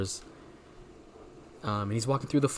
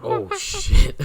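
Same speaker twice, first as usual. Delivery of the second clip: real shock, much higher and louder; surprised outburst; 10 dB louder than usual. Real, not acted. Listener hearing the senator became a gorilla, loud high shocked cry. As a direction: blurt it out in genuine disbelief.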